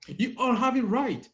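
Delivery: high, loud and pleased; cheerful announcement. Real, not acted. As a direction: announce that everyone is right. Teacher announcing result.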